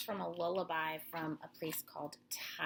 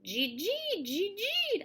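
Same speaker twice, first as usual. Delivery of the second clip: higher, louder squeaky gee; mouse squeak; 11 semitones higher, 5 dB louder than usual. Acted. Reading to children, mouse squeaks. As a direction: squeak like a fat gray mouse.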